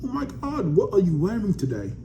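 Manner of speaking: lightly put-on British accent, high and soft. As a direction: imitate a gushing British lady. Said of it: "She got a British accent".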